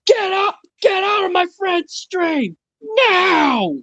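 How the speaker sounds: high-pitched strained yell; Walter Hobbs yell. Acted. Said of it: "yell just like Walter did".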